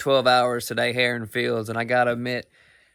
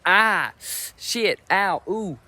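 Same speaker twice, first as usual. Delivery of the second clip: mock pain yelp; 5 semitones higher, much slower than usual. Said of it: "I reacted accordingly".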